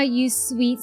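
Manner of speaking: usual speaking voice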